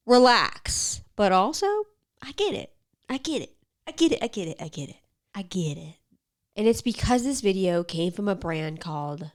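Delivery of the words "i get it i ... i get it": playful repeats. Acted. Conceding to conspiracy theorists, playful fading repeats. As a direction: toy with each repeat, fading out. Repeating phrase in varied voices.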